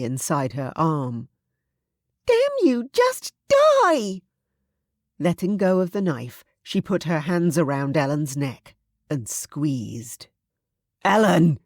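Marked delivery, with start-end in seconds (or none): 2.2-4.2 high, loud, shrieking fury; frenzied rage. Acted. Louisa screaming as she strangles Ellen, frenzied. 11.0-11.6 loud call up the stairs; roaring a name. Acted. Thomas racing upstairs calling Ellen, roaring. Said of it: "Thomas roared her name".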